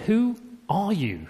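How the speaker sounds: slow, measured probing question; priests' simple question. Retold or imitated. P=A. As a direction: priests to John, asking slowly who he is. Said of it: "They ask a simple question".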